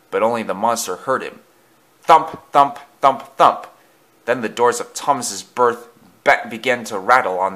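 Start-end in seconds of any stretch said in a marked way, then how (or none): 2.0-3.7 heavy, punched thumps, louder and higher; thumping noise. A narrator's reading. Noise comes closer to Thomas, loud punched thumps. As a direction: hit each thump like a blow.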